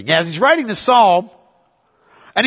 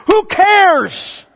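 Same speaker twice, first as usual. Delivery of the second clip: exasperated; 9 semitones higher, 3 dB louder than usual. Real, not acted. Dismissing the internet dress argument.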